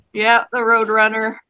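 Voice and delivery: ordinary delivery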